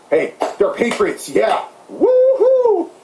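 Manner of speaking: slow sarcastic praise ending in a high whoop; hammed-up patriot whoop; mock cheer. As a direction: cheer them on with a sneer. Sarcastically cheering January sixth rioters.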